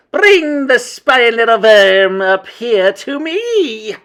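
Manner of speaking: slow, very high, loud shriek; shouted witch command. A narrator's reading. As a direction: shout it imperiously, stretching the words. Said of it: "shouted the Grand High Witch".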